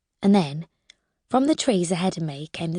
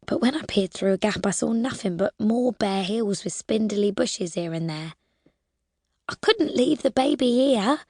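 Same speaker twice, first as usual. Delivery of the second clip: usual speaking voice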